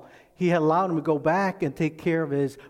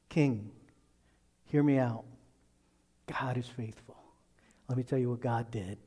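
breathy whisper, barely voiced; hushed whisper of faith; whispered confidence; 4 semitones lower, 5 dB quieter than usual. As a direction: lean in and whisper it like a secret. David reassures king, voiced intimately.

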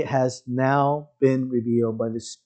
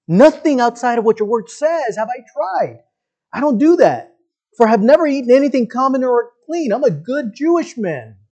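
higher, earnest, self-justifying plea; protesting Peter; 7 semitones higher than usual. Pretend. Peter insisting to God he keeps dietary laws, higher plea. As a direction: argue your clean record to God. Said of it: "Peter's like".

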